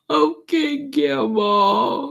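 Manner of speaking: imitating a tearful child, very high-pitched; high little mock-crying voice. Scolded granddaughter answers her grandma, imitated crying child. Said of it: "She was crying".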